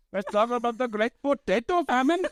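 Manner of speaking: high-pitched mock Indian-Irish accent. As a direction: imitate a botched Irish accent that comes out Indian.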